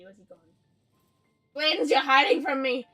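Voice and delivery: ordinary talk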